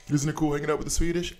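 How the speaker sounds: high, fast, chirpy mock question; mocking clueless American. Streamer parodies Americans asking about Swedes, high and quick. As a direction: play the oblivious American, eager. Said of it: "It's like".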